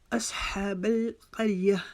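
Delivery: slow असहाबल क़रिया with a long "haa"; careful chanted model of असहाबल क़रिया; measured recitation. Shown as a demonstration. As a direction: stretch the "haa" in असहाबल, then stop on क़रिया. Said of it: "एक अलिफ की मिकदार इसको लंबा करेंगे".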